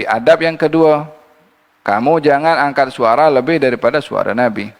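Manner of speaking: normal talking voice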